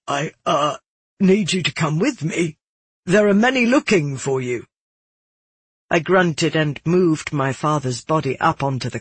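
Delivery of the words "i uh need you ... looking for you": plain reading voice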